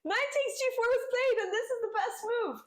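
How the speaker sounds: excited. Real, not acted. Sudden excitement spotting chess tactic.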